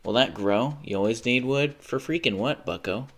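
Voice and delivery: ordinary talk, nothing marked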